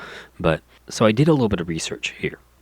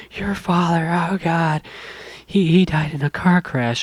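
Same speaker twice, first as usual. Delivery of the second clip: mock sobbing mom; 10 semitones higher than usual. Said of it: "her mom breaks down ... starts bawling".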